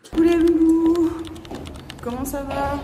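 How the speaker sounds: bright, high, loud hello to viewers; excited greeting. Real, not acted. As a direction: greet the camera with a burst of energy. Greeting viewers in a new vlog clip.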